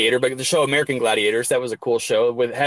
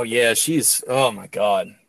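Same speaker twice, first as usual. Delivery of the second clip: ordinary talk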